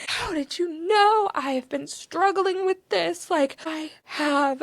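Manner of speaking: high-pitched, drawn-out tearful plea; retold bawling. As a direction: imitate wailing it out, slow and high. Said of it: "I just start bawling".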